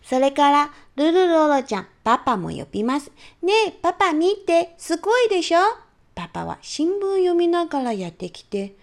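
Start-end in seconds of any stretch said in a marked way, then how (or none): 3.4-5.6 put-on much higher, louder child calling out; excited child. Little girl calling mom to see her castle, excited and high. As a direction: show off to mom, bursting with pride. Child calls dad to look.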